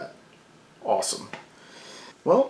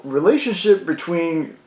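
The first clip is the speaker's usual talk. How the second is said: usual speaking voice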